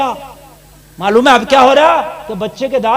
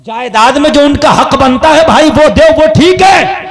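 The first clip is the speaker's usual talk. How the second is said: preaching shout; 4 semitones higher, 7 dB louder than usual. Acted.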